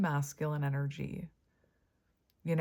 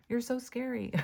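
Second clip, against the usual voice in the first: high mock-fearful sarcasm; sarcastic; 7 semitones higher than usual. Reader teasing the viewer about being feared, sarcastic. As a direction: say it high, teasing, not meaning it. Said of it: "That's sarcasm".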